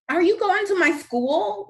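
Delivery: high bright call across the street. A narrator's reading. Girl on bike calls to Joseph, loud call. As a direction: call out to a passing boy. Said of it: "Whoosh calls".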